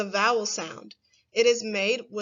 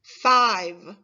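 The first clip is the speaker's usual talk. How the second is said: said on its own, slow and clear; clear test word; 7 dB louder than usual. Shown as a demonstration. Teacher saying a test word for children to listen to, clearly. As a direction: present the word for careful listening. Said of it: "You have to do your best to listen".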